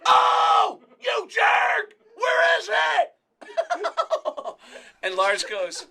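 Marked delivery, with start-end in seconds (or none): 0.1-4.5 full-throated, very high comic scream; Sam Kinison. Acted. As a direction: scream each phrase like a ranting comedian. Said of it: "Sam Kinison is here".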